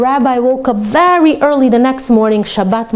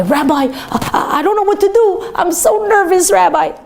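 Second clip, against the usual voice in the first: nervous; 9 semitones higher than usual. Acted. Nervous soldier runs in to rabbi.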